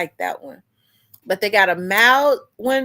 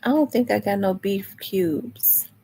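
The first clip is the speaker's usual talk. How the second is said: whisper. Real, not acted. Muttering to herself about ingredients.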